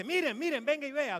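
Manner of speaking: urgent, high, loud call; excited shouted summons. Acted. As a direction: summon everyone over to look, high and loud. Acts out pastor summoning onlookers.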